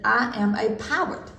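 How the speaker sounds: slow "poet" said as PAH-wut; mispronounced parrot. A narrator's reading. Parrot trying to say parrot, saying "poet" as PAH-wut. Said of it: "which she couldn't say parrot".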